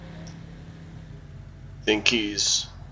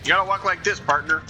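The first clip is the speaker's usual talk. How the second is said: imitating the car salesman; 8 semitones higher, 3 dB quieter than usual. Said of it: "I wanna walk like that".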